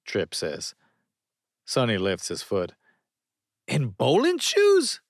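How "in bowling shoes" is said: slow, much higher and louder disbelief; incredulous. A narrator's reading. Sonny lifting his foot, slow high incredulous question. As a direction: stretch out the shock.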